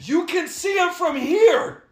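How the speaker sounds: high, slow, stretched-out yell; exasperated shouting. Real, not acted. Host arguing about antennas seen from here, shouting. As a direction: shout each word in disbelief.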